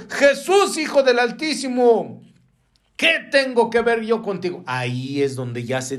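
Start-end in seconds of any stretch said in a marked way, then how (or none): 0.1-4.6 acted crying out. Preacher quotes the Gadarene addressing Jesus, high acted cry. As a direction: call out high as the possessed man would. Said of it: "y gritando a gran voz dijo".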